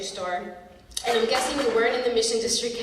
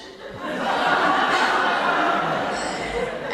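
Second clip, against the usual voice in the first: long breathy laugh; real laughter trailing off; 4 semitones higher, 4 dB louder than usual. Real, not acted. Laughing after the insult.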